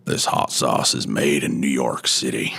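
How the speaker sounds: gritty cowboy with long vowels; cowboy outlaw voice. Shown as a demonstration. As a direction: growl it like a Western outlaw. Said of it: "Red Dead Redemption cowboy outlaw voice".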